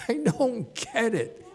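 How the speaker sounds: laughing, slow, amused disbelief; laughing at how the Chinese gets translated. Real, not acted. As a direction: chuckle through it in real amusement.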